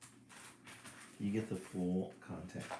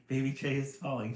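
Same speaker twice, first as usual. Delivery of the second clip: a touch louder mild shout; 6 semitones higher, 5 dB louder than usual. A narrator's reading. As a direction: sound the alarm lightly. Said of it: "shouts the squirrel".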